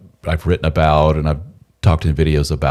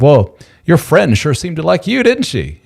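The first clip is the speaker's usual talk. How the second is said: jealous wife; 9 semitones higher, 3 dB louder than usual. Retold or imitated. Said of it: "It's like".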